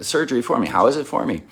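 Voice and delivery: normal talking voice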